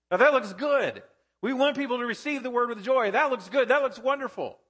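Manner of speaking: bright, high, overeager approval; mock enthusiasm. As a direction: sound impressed, a bit ironic. Mock approval of joyful reception.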